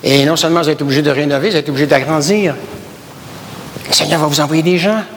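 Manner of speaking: ordinary talk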